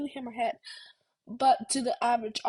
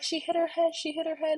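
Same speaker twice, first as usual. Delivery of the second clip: higher-pitched retold alarm, lightly imitated; alarmed bystander; 5 semitones higher than usual. Bystander tells rink workers about a fall, imitated alarm. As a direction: mimic an onlooker reporting an accident. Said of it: "He was like".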